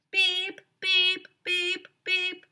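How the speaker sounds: high, loud mock alarm beeps; high, even electronic beeps; imitated beeping. A narrator's reading. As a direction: beep like a smoke detector. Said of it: "went the smoke detector".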